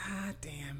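very soft whispered curse; whispered exasperation. Acted. Co-host voicing Casey as the game resumes, whispered curse. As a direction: whisper it under the next line.

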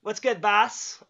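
slow, unbothered greeting from a guy just kicked awake; lazy slacker. Retold or imitated. Worker mimics the coworker greeting his boss slowly. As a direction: drawl it out like nothing's wrong.

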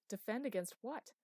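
high, loud alarmed question. A narrator's reading. Chloe questioning the master about danger, alarmed. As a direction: demand an answer in rising alarm.

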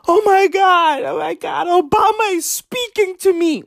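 high, squealing fangirl voice; mocking a swooning fan. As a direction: gush like a starstruck fan.

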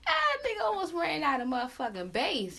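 a loud laughing exclamation; loud laughing outburst. Real, not acted. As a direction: blurt it out between laughs. Laughing about a voice squeak.

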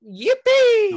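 high, loud squeal of joy; excited yippee. Pretend. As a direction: shriek it like a cheering kid. Mock celebrating height.

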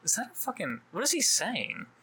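acted-out confused homeowner; puzzled onlooker couple. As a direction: call to your partner, puzzled by distant noise. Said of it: "Think about being the couple".

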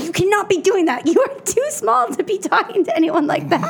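high-pitched imitation of a scolding guard; high, insistent mock reprimand. Security guard scolding her, retold.

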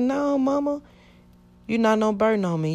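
high, earnest reassurance; reassuring. Retold or imitated. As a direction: comfort a worried mother. Reassuring mama she's no burden.

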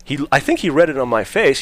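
usual speaking voice

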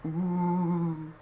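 slow, eerie put-on Sean voice; spooky voice. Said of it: "does he have, like, a spooky voice?".

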